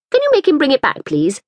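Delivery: lightly voiced fretful mother. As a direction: plead a little for the ball back. Woman character's voice.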